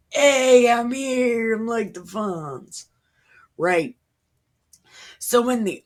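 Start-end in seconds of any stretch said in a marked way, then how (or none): 0.1-2.8 God as a cool, swaggering guy, starting higher and louder; cocky God. Retold or imitated. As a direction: play God as a laid-back show-off. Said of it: "And then God's like".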